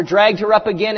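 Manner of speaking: usual speaking voice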